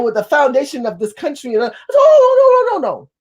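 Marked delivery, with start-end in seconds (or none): 1.9-3.1 long, high, loud "oh"; soaring protest; loud high objection. Retold or imitated. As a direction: wail it out to cut them off. Cutting off the man's claim.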